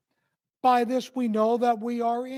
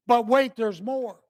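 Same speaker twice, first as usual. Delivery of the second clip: louder, punchy TV pitchman; late-night commercial pitch; 3 semitones higher, 7 dB louder than usual. Acted. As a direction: sell it like a late-night infomercial. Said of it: "Kinda like a late night commercial".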